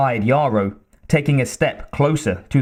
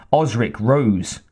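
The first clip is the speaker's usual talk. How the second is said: ordinary delivery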